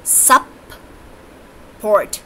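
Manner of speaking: "सप-पोर्ट" slowly, in two separate beats; long pause inside "सप-पोर्ट", after सप; split into syllables. Shown as a demonstration. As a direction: sound out "सप-पोर्ट" one syllable at a time. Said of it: "Like this".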